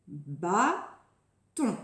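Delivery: "Bâton" in two slow, distinct beats; Bâton cleanly broken before its last syllable; separated syllables. Shown as a demonstration. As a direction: say bâ, wait, then ton for "Bâton". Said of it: "Il y a deux syllabes".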